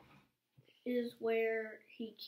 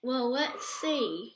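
usual speaking voice

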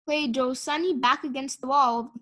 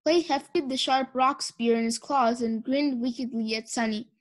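usual speaking voice

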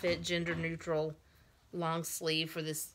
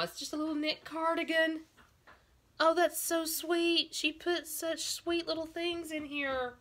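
cooing delight; 10 semitones higher than usual. Real, not acted. Collector unpacks a little knit cardigan, cooing. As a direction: melt over something tiny and sweet. Gushing over tiny doll clothes.

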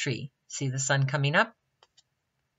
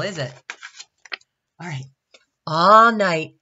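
ordinary delivery, nothing marked

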